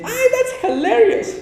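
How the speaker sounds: very high, loud, drawn-out delight; amused exclamation. Acted. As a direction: squeal it like an American who finds it hilarious. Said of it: "En inglés dicen".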